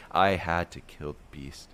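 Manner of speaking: ordinary delivery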